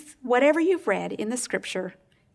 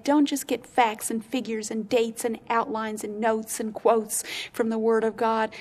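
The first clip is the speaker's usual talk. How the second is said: normal talking voice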